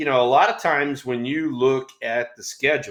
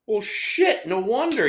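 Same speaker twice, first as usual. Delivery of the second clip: much higher-pitched "it all clicks" reaction; retold sudden realization; 9 semitones higher, slower than usual. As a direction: replay the moment it dawned on you. Player suddenly understands coach's advice.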